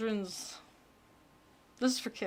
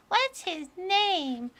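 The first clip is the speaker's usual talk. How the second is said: put-on small child asking, lighter voice; little kid voice; 8 semitones higher, much slower than usual. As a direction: play a curious little kid wide-eyed. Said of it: "the little kid voice that I'm doing".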